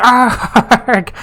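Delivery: high, drawn-out mock scream; hammed-up frustrated yell; punk cry. Stressed cook lets it all out, hammed-up scream. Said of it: "began to make strange cries".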